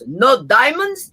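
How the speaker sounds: lightly voiced Russian man, questioning; Russian accent. Comedian imitating the Russian mirror designer, Russian accent. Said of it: "That Russian guy is Anatoly Salgovich".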